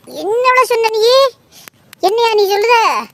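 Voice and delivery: high, outraged retort. Acted. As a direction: shoot back, outraged. (கொதிப்பான எதிர்க்கேள்வி)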